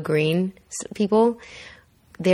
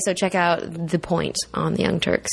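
ordinary talk, nothing marked

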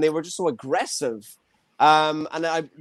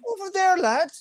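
mock Cork accent. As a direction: imitate a Cork local barking directions. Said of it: "they're like".

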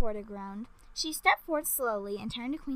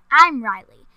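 loud and proud; proud announcement; 14 dB louder than usual. A narrator's reading. Riley proudly tells the queen her name, loud. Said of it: "she announced as though proud".

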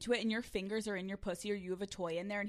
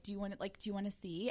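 seductive question; 3 semitones lower, 8 dB quieter than usual. Acted. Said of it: "you're like".

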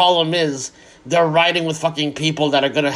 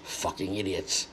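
much lower and much softer, slow, under the breath; muttered insult; 9 semitones lower, 13 dB quieter, slower than usual. Real, not acted. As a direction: mutter it to yourself in disgust. Muttered aside after a rant.

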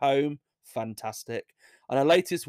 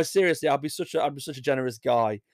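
ordinary talk, nothing marked

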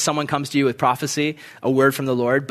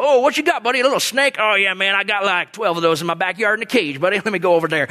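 hardcore biker neighbor, cocky and casual; Danny; 3 semitones higher, faster than usual. Acted. Crazy neighbor answering a plea to catch a snake, cocky swagger. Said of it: "like, just hardcore guy".

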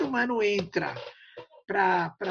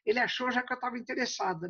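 ordinary talk

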